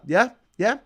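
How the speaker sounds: normal talking voice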